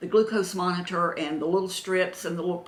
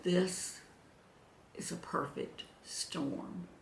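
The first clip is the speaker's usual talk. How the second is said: hushed whisper; 10 dB quieter, slower than usual. Retold or imitated. Doctor telling the couple the diagnosis, hushed whisper. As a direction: drop to a breathy hush for the verdict. Doctor delivering grave news.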